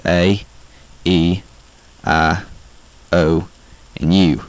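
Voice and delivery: slow Southern vowels for repeating; Southern accent vowels. Shown as a demonstration. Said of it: "try to say it with me".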